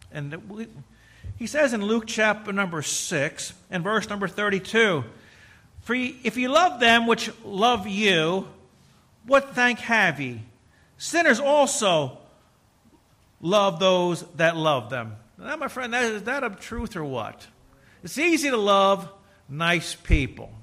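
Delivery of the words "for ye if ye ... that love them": solemn scripture reading. Acted. Scripture read from Luke.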